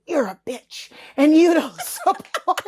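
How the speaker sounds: slow, laughing through an insult; half-laughing as she reads it; laughing quote. Retold or imitated. Quoting the hate DMs, laughing through it. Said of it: "It would be nobody and then".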